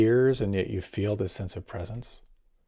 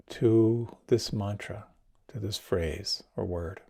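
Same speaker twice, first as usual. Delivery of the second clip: guided meditation. Acted. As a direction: ease the listeners into calm.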